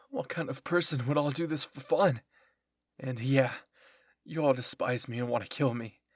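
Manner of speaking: loud, upset self-defence; anguished outburst. A narrator's reading. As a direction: burst out loudly at former friends. Cornered traitor defends himself to friends.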